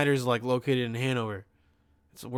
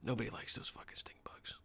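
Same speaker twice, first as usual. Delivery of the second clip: hushed whisper, much softer, low; whispered aside; 4 semitones lower, 17 dB quieter than usual. Real, not acted. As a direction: drop to a secret whisper for the aside. Aside about stink bugs.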